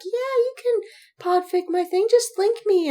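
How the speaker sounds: sing-song mock of a happy author; hammed-up eager author voice; imitating eager fans. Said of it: "these poor people who have been like".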